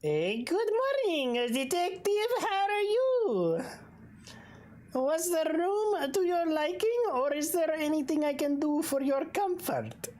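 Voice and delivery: high, eager hotel host voice; hotel owner greeting the detective, high and eager; Ernest Bull. Acted.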